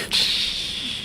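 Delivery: unvoiced hush; quiet them down playfully; a conspiratorial shush. Real, not acted.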